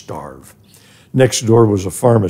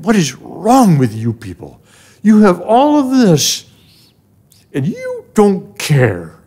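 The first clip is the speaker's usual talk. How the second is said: raging at the crowd, pitch climbing higher and louder; inner rage; 9 semitones higher, 3 dB louder than usual. Retold or imitated. Boy outside a store raging at passing New Yorkers, loud and rising. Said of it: "And I fell into a rage".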